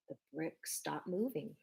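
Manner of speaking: usual speaking voice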